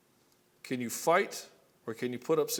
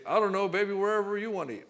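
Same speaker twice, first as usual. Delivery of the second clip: a higher, louder mock of a passive guy; indecisive boyfriend; 7 semitones higher, 4 dB louder than usual. A boyfriend dodging the dinner choice, in a higher, louder passive voice. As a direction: shrug it back to her, hands off. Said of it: "And you say".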